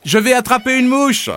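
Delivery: put-on high, eager child voice; a boy shouting as he runs; excited boy.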